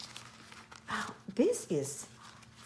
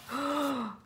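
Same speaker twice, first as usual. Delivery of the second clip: high, soft gasp; small gasp of surprise; delighted gasp; 7 semitones higher, 3 dB quieter than usual. Real, not acted. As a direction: catch your breath in wonder. Sees next item.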